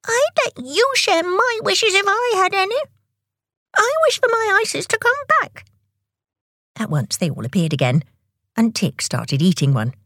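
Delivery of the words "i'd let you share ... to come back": high squeaky indignation; very angry. A narrator's reading. Tick wishing his ices back, angry high squeal. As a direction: protest in a very high little voice. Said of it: "He was very angry".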